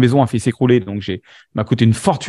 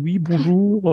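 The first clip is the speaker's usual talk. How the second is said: slow, higher, very polite phone greeting; 4 semitones higher, much slower than usual. Retold or imitated. Calling the boss he just met, in a slow, higher polite phone voice. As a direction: put on a formal telephone manner, slowly.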